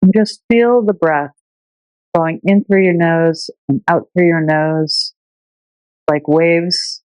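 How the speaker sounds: unhurried meditation teacher voice with long pauses; calm, measured breathing-meditation delivery; guided meditation. Shown as a demonstration. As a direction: guide listeners gently through their breath, leaving space between phrases.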